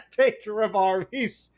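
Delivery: high, slow, laughing repeat. Real, not acted. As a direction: break into laughter on the words. Laughing at joke.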